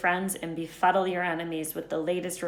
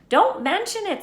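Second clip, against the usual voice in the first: usual speaking voice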